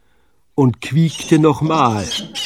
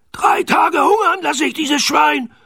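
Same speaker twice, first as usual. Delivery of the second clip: very high, strained pirate bawl; Knurrhahn bawling; 3 dB louder than usual. A narrator's reading. Pirate captain threatens to starve pig, bawling. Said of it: "gröhlte Knurrhahn".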